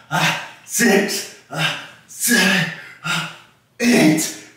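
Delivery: strained counting under effort; straining through reps. Real, not acted. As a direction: grunt out each rep. Counting reps mid push-up set.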